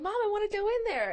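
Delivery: put-on high childish whine; kid's voice. Kid in restroom begging mom, high childish whine. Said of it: "They're like".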